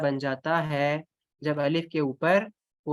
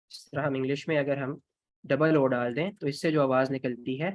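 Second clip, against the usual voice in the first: ordinary talk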